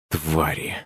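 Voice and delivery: soft, bitter cursing to himself; muttered under breath. A narrator's reading. Artur curses the security service under his breath, quietly. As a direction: spit the word quietly, not for anyone's ears. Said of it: "забормотал себе под нос Артур".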